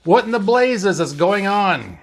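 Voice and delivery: high, agitated question; exasperated outcry, falling at the end; yelled demand. A narrator's reading. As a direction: demand an explanation, pitched well up. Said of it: "McCoy yelled".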